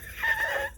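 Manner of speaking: a high, breathy giggle; high squeaky laugh. Real, not acted. Laughing at the janky setup.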